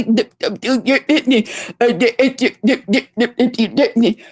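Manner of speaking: stuttering mockery of the other woman. Mocking the woman who can't get her words out, exaggerated stutter. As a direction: stammer on "d-" over and over, laughing at her. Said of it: "she couldn't get her words together".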